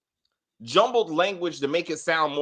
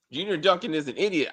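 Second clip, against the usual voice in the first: usual speaking voice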